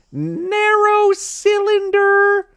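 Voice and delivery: very high, loud, slow squeal; mocking disbelief. Real, not acted. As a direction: stretch it out in mock horror. Mocking a line just read.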